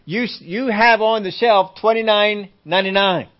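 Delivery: acted indignant objection; insistent customer. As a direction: argue the price loudly. Acted customer disputing a price.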